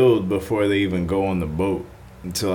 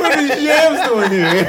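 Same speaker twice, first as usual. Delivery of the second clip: laughing; 5 dB louder than usual. Pretend. Joking riff on yams.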